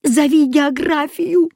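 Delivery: high, urgent cry of alarm; alarmed high-pitched wail; yelling. A narrator's reading. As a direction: yell in panic, pitch way up. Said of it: "завопил Кузя".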